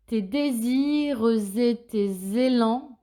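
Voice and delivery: drawn-out dictation, clear Z before "et" and "élans"; marked liaisons. Shown as a demonstration. Teacher repeats for pupils, sounding the Z links in "désirs et", "tes élans". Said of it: "Écoutez bien les liaisons ici".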